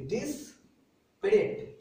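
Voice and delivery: डिस्परेट stretched out very slowly, syllable by syllable; very slow pronunciation. Shown as a demonstration. Teacher giving the class the new spelling, very slowly. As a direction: draw डिस्परेट out as slowly as you can. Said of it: "आई को ई कर दीजिए".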